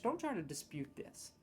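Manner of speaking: ordinary talk, nothing marked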